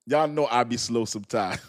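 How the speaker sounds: ordinary delivery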